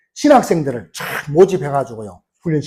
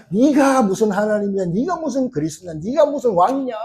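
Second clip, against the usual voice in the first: high-pitched jeer, not shouted; mocking; 4 semitones higher than usual. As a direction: play scoffers challenging someone, pitched up. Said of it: "사람 막 조롱합니다".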